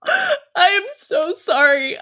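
high and slow, close to crying; tearful sorry. Acted. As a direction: break into tears on it.

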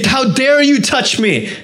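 high-pitched, indignant rebuke; mock angry yell. Preacher voicing the Jesus the woman fears, mock angry yell. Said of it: "he's gonna yell at me and say".

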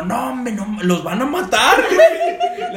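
high, loud, slow laughing urgency; urgent plea. Retold or imitated. Urging Tanque to open the gate, high and laughing. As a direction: laugh while pleading urgently. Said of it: "Y digo".

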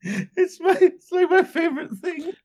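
high, giggling through words; laughing too hard to talk. Real, not acted. Laughing at own joke.

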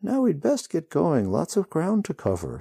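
high, breezy, offhand; too casually. A narrator's reading. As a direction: brush it off far too lightly. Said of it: "too casually".

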